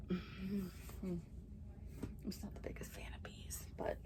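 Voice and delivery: sigh, then whisper the confession; a hushed, sheepish admission. Real, not acted. Confessing dislike of bees.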